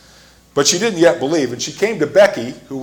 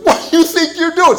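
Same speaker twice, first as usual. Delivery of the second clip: sharply higher, louder indignant cry; indignant shout; 6 dB louder than usual. Retold or imitated. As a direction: shriek it back in outrage. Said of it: "loud confrontation in the library".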